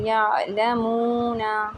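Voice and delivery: slow, drawn-out यालमूना, not heavy; careful Quran recitation of यालमूना; slow and light. Shown as a demonstration. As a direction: stretch यालमूना slowly without thickening it. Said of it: "इसको आराम से पढ़ना है".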